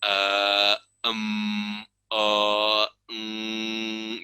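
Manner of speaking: drawn-out flat eh, em, eh, mm; thinking hums of a nervous speaker; hesitation fillers. Shown as a demonstration. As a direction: show the filler sounds of a speaker stalling. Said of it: "yang namanya gap".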